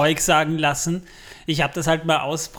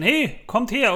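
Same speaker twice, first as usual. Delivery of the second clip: mock statue call; 8 semitones higher than usual. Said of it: "heißt das dann".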